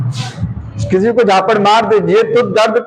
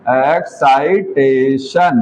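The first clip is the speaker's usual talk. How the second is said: Excitation stretched out even slower and dropping low; drawn-out repeat; 7 semitones lower, much slower than usual. Shown as a demonstration. Teacher repeating the key word for students, very drawn out. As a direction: let excitation trail out long and low to fix it in memory. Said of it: "इसका meaning है कि".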